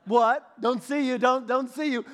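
mock-innocent dog looking away; dog pretending not to see. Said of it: "They're like".

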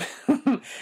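a sudden high squeal of a laugh; high burst of laughter. Real, not acted. Laughing at herself after praising the accent, a high burst. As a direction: let a high giggle burst out. Amused at her accent remark.

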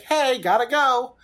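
loud, high, drawn-out farewell; singsong mock goodbye; breezy call-out. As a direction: call it out in passing. Mimics a quick cameo exit.

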